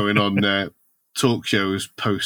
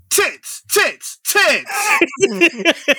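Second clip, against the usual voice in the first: very high-pitched shouted exclamations; exclaimed headline; 3 dB louder, much slower than usual. Acted. Opening the tabloid story with high, shouted exclamations. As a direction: cry each word out separately, high.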